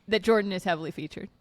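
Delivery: ordinary delivery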